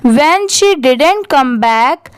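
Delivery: very slow word-by-word model reading with pauses; slow paragraph reading. Shown as a demonstration. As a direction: read it out for learners, pausing between phrases. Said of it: "वहाँ पर थोड़ा सा रुकिए".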